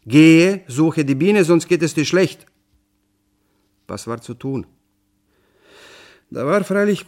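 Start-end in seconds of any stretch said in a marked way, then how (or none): 0.0-2.4 loud, higher furious order; the father shouting at his son; angry father. Acted. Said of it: "Zornig rief mein Vater". (zorniger, lauter Befehl des Vaters)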